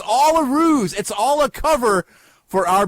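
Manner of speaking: high, loud mock reveal; excited. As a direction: announce the big secret.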